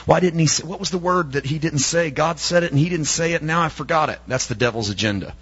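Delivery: normal talking voice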